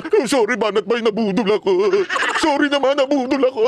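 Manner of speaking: high, whiny mock-sorry voice; a turncoat supporter whining sorry to the vlogger; mock apology. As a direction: mimic a sheepish convert begging forgiveness. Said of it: "maniniwala na kayo na nabudol siya".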